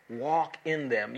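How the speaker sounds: "walk" hit hard, whole phrase slowed; emphasis on walk in them. Shown as a demonstration. Preacher underlining words for congregation, "walk" stressed and slow. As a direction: press each word of "walk in them" slowly. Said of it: "underline those three words".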